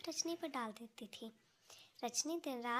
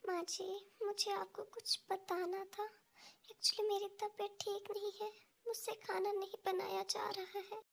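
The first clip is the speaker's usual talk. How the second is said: put-on higher, timid young wife's voice; meek daughter-in-law; 7 semitones higher than usual. Daughter-in-law timidly telling her mother-in-law she is sick. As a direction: plead weakly that she is unwell.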